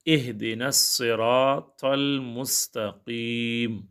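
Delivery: very slow, drawn-out melodic recitation; Quran recitation. Acted. Teacher recites the Fatiha for his students, slow Quranic chant. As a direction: chant each word slowly like in prayer.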